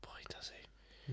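whisper. Real, not acted.

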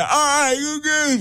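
very high-pitched mock cry of a patient; comic high garbled moaning; imitated dental patient. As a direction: squeal like a patient in the dentist's chair. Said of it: "falan yapabilir yani".

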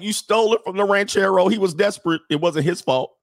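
high-pitched imitation of an aggrieved complainer; mock protest. Host voicing future land claimants, mock protest. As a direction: plead the ranchero's case in mock outrage. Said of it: "They're gonna be like".

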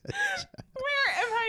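high, slow, tear-choked laughing; crying laughing. Real, not acted. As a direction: laugh till you cry. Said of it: "I'm crying".

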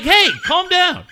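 high, loud, slow check on him; drawn-out comic scolding; mock reprimand. Retold reproof after son swears.

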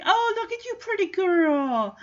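a dognapper cooing at a pretty dog; fake sweet talk. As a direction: coax the dog with sugar.

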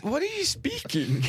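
high, incredulous imitation of his wife; mock wife. Wife mocking husband's put-on English accent, high and incredulous. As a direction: squeak it out like a wife baffled by the accent. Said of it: "She says".

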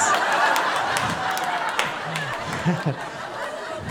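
long unvoiced laugh; breathy laugh. Real, not acted. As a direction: keep laughing. Laughing at typo.

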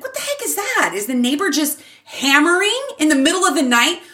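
high-pitched, incredulous reenactment; baffled indignation. Retold or imitated. Mom hearing a hammer at night, acting baffled. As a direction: act out being startled and annoyed.

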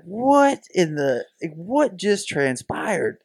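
high, very slow, drawn-out bafflement; stunned disbelief. Retold or imitated. Onlooker reacting to the screamed outburst, stunned disbelief. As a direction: stretch each word as a dumbfounded onlooker.

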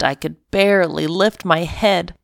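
ordinary delivery

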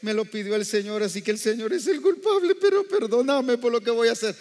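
mock weeping. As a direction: play a father whining through fake tears. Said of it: "no se puso a llorar".

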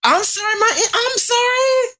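squeaky high sorry; hammed-up high apology; high mock apology. Mock apology after being scolded.

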